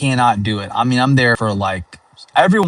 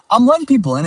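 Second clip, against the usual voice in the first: high, sing-song and louder; mock friendly; 14 semitones higher, 5 dB louder, much faster than usual. Real, not acted. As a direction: sweetly wave the driver in, teasing. Letting a driver merge in.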